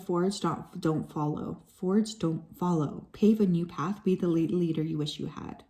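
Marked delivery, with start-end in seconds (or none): 0.0-3.1 slow reading. Real, not acted. Reads card text slowly.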